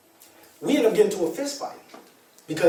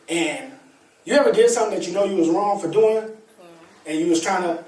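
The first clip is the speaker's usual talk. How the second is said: normal talking voice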